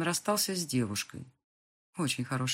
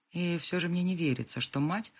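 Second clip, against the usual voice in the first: ordinary delivery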